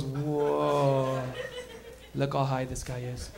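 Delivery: a hint of a stunned passenger; lightly voiced bus rider. Bus riders noticing he's high.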